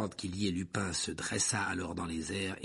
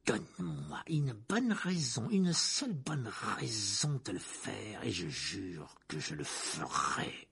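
menacing villain; slower than usual. Acted. Teacher dares his enemy at wandpoint, menacing.

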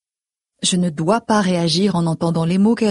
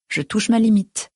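usual speaking voice

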